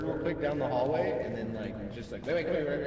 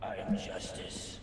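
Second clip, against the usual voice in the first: whisper; 11 semitones lower, 12 dB quieter, slower than usual. Acted. Dramatic whispered game line.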